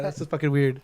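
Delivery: ordinary talk, nothing marked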